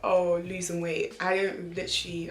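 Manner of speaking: normal talking voice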